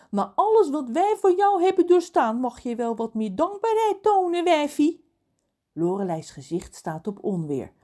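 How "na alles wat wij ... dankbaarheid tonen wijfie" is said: raised, high-pitched complaint; indignant. A narrator's reading. John demands gratitude from Lorelei, indignant. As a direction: complain as one owed some thanks. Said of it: "zegt John verontwaardigd".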